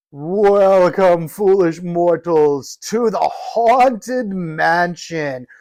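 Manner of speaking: slow, eerie haunted-house host voice; drawn-out spooky ghost host greeting; booming echoing villain monologue. Pretend. Reciting the ride's Ghost Host spiel.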